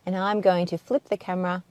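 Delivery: normal talking voice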